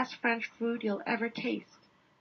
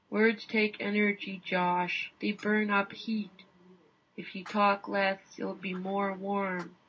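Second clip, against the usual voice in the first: patient Inuit guide; 3 semitones lower, slower than usual. Retold or imitated. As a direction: hand down calm wisdom, sparing words. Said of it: "he offered some unexpected advice".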